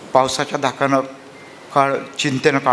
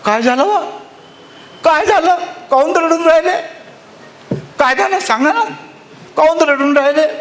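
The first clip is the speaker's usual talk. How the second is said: high, loud anxious pleading; worried wife pleading; 11 semitones higher, 3 dB louder than usual. Acted. Wife anxiously begs her sobbing husband. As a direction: beg him to say what's wrong.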